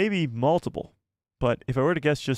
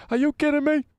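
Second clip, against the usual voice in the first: much higher and louder, hammed-up disbelief; mock outrage; 13 semitones higher, 3 dB louder than usual. Streamer losing his place, squealing mock outrage. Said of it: "I hate it when that happens".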